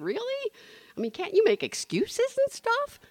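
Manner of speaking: high incredulous protest; mock disbelief. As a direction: pretend to bargain out of it.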